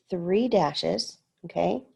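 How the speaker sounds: ordinary delivery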